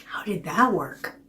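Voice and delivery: plain reading voice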